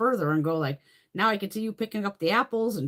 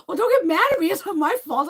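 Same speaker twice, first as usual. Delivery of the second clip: defensive protest; 12 semitones higher, 8 dB louder than usual. Retold or imitated. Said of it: "And I'm like".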